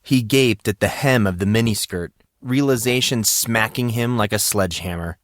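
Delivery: usual speaking voice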